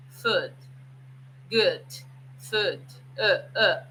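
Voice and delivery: food, good, food, then a short throaty uh; glottal demo. Shown as a demonstration. Teacher showing how of shrinks to uh. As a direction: drop into a clipped uh in the throat. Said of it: "É como se eu falasse assim".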